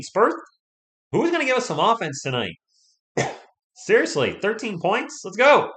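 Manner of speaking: loud, high pleading cheer; rally the team, loud and high; frustrated fan shouting at his team; fan urging. Real, not acted.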